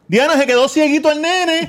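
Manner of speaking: high, hammed-up alarmed voice; mock alarmed parent. Father jokingly voices a worried parent to Diana, mock alarm. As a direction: play the panicked parent for laughs.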